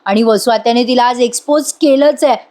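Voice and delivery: usual speaking voice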